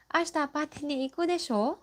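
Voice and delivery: high, fast, desho lifted at the end; rising question tune. Shown as a demonstration. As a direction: ask "Ashita pati ni iku desho?" with a rising tune on desho, checking for agreement. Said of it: "this desho is said with the rising intonation".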